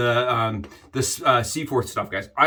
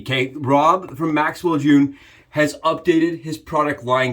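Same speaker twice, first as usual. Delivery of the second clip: normal talking voice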